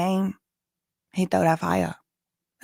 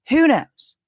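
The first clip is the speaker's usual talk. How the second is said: playful; 7 semitones higher, 6 dB louder than usual. Acted. Playful aside about personalities.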